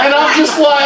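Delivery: ordinary delivery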